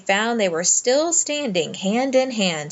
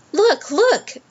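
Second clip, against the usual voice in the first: high, louder excited cry; 11 semitones higher, 4 dB louder than usual. A narrator's reading. Boy spotting the ring on the guinea pig, excited cry. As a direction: call out as he spots the ring. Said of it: "cried Diggory".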